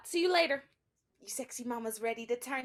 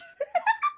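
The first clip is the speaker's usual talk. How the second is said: a giddy high laugh; high squealing laugh; 4 dB louder than usual. Acted. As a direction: squeal a giggle.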